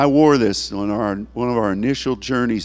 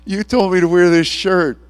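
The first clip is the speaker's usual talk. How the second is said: laughing it out loud; laughing at God; 6 semitones higher, 5 dB louder than usual. Retold or imitated. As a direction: laugh while telling God off. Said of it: "I started laughing".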